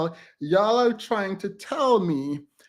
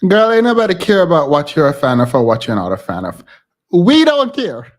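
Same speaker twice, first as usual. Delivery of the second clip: loud dismissive yell; 11 dB louder than usual. Real, not acted. Yelling back at the critic in the clip, loud and dismissive. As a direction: blast the critic off the stage.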